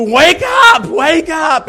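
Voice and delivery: full, high-pitched shout from the ground; yelling. Acted. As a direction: yell up hard at a sleeper in a tree. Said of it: "yelling at you".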